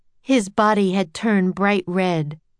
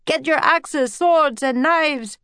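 higher rallying shout; yelling; 8 semitones higher, slower than usual. A narrator's reading. Another sailor rallying the crew, yelling. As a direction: call the men to arms. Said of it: "yelled another".

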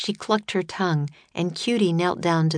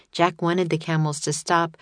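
ordinary delivery

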